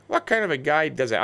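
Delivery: ordinary delivery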